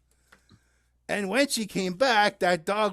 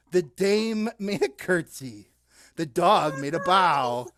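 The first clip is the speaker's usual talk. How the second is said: ordinary talk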